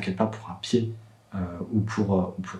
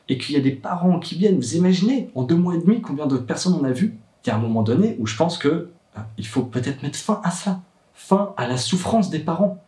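higher-pitched, emphatic appeal; impassioned plea; 6 semitones higher than usual. Real, not acted. Father pleading for an end to parents' suffering, impassioned. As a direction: press each point with rising urgency.

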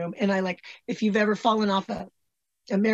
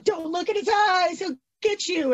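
put-on higher, hushed childlike warning; a kid scaring herself about glowing eyes; mock childish fear; 10 semitones higher than usual. Remembering childhood cartoons, a mock-scared high warning.

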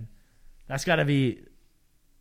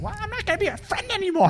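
put-on high whiny kid sister; pouting grade-school girl. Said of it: "my sister would come home and be like".

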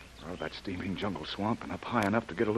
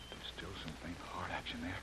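hushed, breathy whisper; 4 semitones lower, 13 dB quieter than usual. Acted. Jim checks the dying girl's heart, whispering. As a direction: whisper it over her still body.

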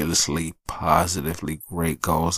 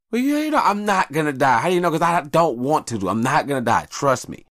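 stubborn back-and-forth; 10 semitones higher, 3 dB louder than usual. Retold or imitated. Quarrel with wife, high voice.

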